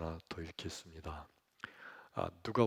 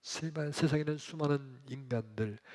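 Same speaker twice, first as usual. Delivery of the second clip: normal talking voice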